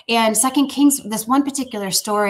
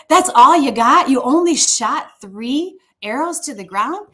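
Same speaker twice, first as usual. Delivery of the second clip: higher, drawn-out incredulous question; retold disbelief; 5 semitones higher, 5 dB louder, slower than usual. Prophet challenging king over three arrows, incredulous. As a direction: act out exaggerated disbelief, slow and high.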